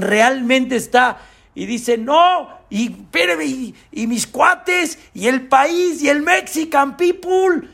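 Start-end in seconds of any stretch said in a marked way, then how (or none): 2.0-7.7 high, slow, strained pleading; beg, reaching for anything; man pleading for his friends and his country, high and strained. Acted.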